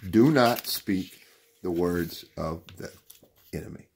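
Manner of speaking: deliberate warning, word by word. Real, not acted. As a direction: let "enemy" drop away after a pause. Slow repeat for emphasis.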